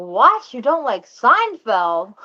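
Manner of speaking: shocked disbelief. Retold or imitated. Shocked at disliking Seinfeld.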